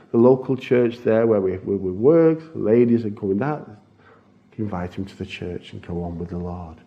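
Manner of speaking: normal talking voice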